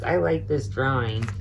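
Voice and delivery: ordinary delivery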